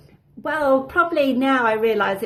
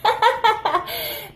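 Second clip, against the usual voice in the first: louder, higher, laughing as she talks; real amusement; 5 semitones higher, 5 dB louder than usual. Real, not acted. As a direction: let real laughter break through the words. Laughing about twigs in her hair.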